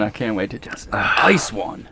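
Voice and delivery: eager hushed whisper. Real, not acted. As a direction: breathe it out in a whisper. Eager hunter whispers to himself.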